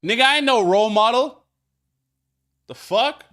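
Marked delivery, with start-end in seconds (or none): 0.0-1.4 loud, high defiant retort; defiant shout. Real, not acted. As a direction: push back hard. Defiant outburst.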